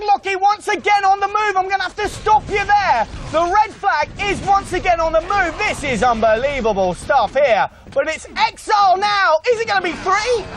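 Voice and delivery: high, shouty live commentary; excited play-by-play. Acted. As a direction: call the action at full pitch. Flag on the move again.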